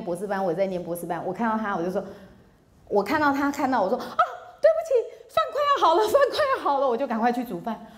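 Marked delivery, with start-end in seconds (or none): 4.0-6.9 put-on high, laughing, flustered young wife; flustered apology. Young wife rushing to reassure husband, high and flustered. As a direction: squeak out a hurried sorry while laughing.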